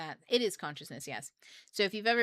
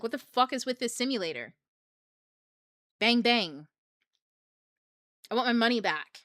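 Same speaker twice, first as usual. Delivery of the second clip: a hammed-up annoyed player, louder; mock frustrated; 4 semitones higher, 6 dB louder than usual. Player complaining about the simulator, mock frustrated and louder. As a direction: play it like a fed-up gamer.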